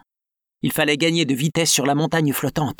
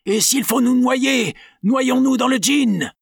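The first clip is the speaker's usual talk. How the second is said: put-on high, loud, slow drunken bravado; Penn's gang shouting they'll drown in gin; rowdy shout; 10 semitones higher, 3 dB louder, slower than usual. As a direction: roar it defiantly. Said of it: "s'écrièrent Penn".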